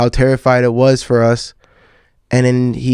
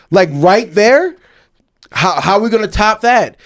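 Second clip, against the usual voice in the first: higher, disbelieving and wide-eyed; amazed excitement; 7 semitones higher than usual. Retold or imitated. Telling a friend about the whale, amazed and excited. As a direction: gush like a kid seeing a whale. Each trip highlight topping the last.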